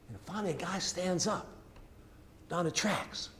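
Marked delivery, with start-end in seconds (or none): none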